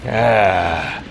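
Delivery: ordinary delivery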